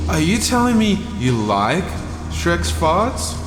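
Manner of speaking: usual speaking voice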